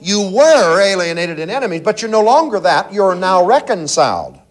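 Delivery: normal talking voice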